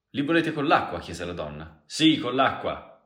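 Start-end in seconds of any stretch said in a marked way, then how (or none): 0.1-1.0 very loud and high; calling out a question. Acted. Barwoman asking customers about water, calling out loudly. 1.8-2.9 loud, high, drawn-out answer; calling back. Acted. Man answering the barwoman about water, calling back loudly.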